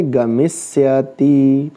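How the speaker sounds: गमिष्यति slowly stretched, as if spelling it out; slow dictation. Shown as a demonstration. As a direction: draw out गमिष्यति syllable by syllable. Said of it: "हम इसे लिखेंगे".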